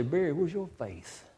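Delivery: hushed talk to oneself; soft, sobering self-admonishment; quiet self-reproach. Retold or imitated. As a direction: question yourself softly, privately. Speaker admonishes self in mirror.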